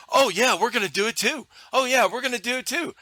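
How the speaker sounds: high-pitched bandwagon imitation; mock eagerness. Internet followers latching onto a ban, mock eager. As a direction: mimic keen followers piling on.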